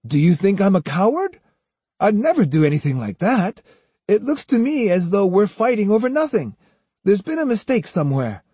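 put-on high-pitched, earnest child; protesting young boy. A narrator's reading. Jotaro argues there's been a mistake, earnestly indignant.

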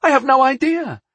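high, loud, gleeful admission; smiling admission. A narrator's reading. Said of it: "I smiled".

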